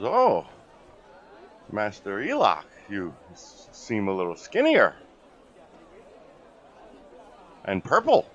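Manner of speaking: lightly voiced goblin, puzzled at his master; goblin servant. Goblin greets the disguised master.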